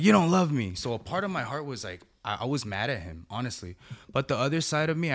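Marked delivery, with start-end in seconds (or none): 0.0-0.7 higher, louder hurt protest from the son; a wounded kid's accusation, pitched up; upset teen son. Retold or imitated. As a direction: throw it back like an upset fourteen-year-old. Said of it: "And he's like".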